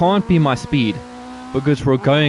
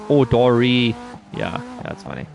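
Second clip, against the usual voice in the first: ordinary delivery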